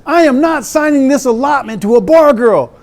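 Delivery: high, loud yelled refusal through a laugh; mock sergeant yell. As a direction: lay down the law laughing. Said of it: "First Sergeant says".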